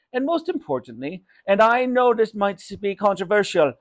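keen speechifying in character; Raj. Acted. Shopkeeper lists snack ideas for mother, earnest.

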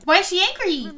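ordinary delivery

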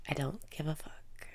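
soft whisper on the out-breath; hushed carefree mantra. Shown as a demonstration. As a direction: let it out in a whisper. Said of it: "Breathe out and say".

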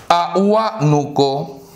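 "A Huánuco" drawn out slowly, a-huá-nu-co; syllable by syllable. Shown as a demonstration. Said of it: "Separamos en sílabas". ("A Huánuco" lento, sílaba por sílaba)

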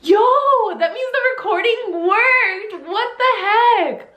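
high-pitched, incredulous exclamation; real astonishment. Real, not acted. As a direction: exclaim in disbelief, pitch shooting up. Discovers the recording had worked.

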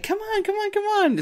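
high, eager come-on call; eager girl beckoning. Retold or imitated. Girl in church calls him to her pew, high and eager. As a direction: wave him up to the front, high and keen. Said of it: "and she's like".

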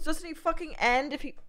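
normal talking voice

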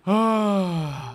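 big, audible sigh; exasperated sigh. Real, not acted. Player told to play it legit, exasperated sigh. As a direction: let out a long, heavy breath. Resigned to doing hard mode.

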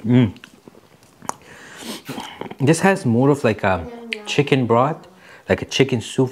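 chewing while talking; talking between bites; mouth full. Real, not acted. Seen 3: Slurps noodles before; lips pursed, chewing while talking until 3.6s.

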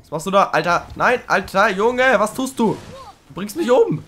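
panicked yelling. Real, not acted. Player panics as Trico nearly kills him, yelling. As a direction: yell in alarm at the beast.

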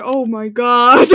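laughing loud, slowed. Real, not acted. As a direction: crack up mid-phrase. Bursts out laughing.

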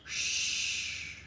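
long hushing shh; wind shh. Retold or imitated. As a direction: hush like wind in leaves. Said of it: "It whispers".

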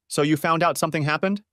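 gently probing question with a lifted ending. A narrator's reading. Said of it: "she asked questioningly but quietly".

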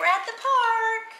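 high, slow pretend mommy. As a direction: coax the baby along, high and slow. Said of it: "Okay, I'll be the mommy".

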